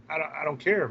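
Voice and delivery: ordinary delivery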